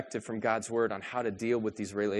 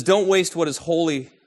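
preacher quoting Jesus to the congregation, raised and forceful; 7 dB louder than usual. Acted. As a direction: declare the words of Jesus. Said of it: "He says".

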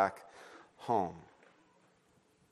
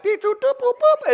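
high electronic beeping; phone beeps; 5 dB louder than usual. Retold or imitated. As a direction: chirp like a smartphone app.

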